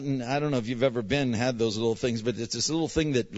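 normal talking voice

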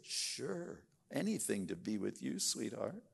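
imitating a smitten boyfriend agreeing; sugary boyfriend. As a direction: play the eager-to-please suitor. Boyfriend's sweet lying reply.

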